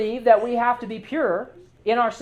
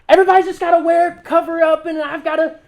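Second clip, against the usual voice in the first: higher, louder mock of a fearful believer; 6 semitones higher, 5 dB louder than usual. Preacher mocks believers who only cover up, a put-on voice. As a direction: sneer at the cover-up crowd in a put-on voice. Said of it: "I'm making fun of people who think that way".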